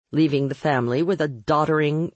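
plain reading voice

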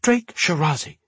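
sharp, high-pitched scolding by full name; snapped. A narrator's reading. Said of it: "Lenora snapped".

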